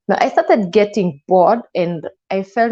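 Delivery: ordinary delivery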